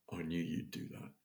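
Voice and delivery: soft, hushed, barely voiced; gentle whisper of God. Retelling God's whisper to his heart, softly hushed. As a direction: breathe it like a secret blessing. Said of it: "it was like God whispered into my heart".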